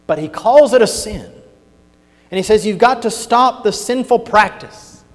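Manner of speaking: full-voiced pulpit warning; fiery preaching. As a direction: preach it up to a strong finish.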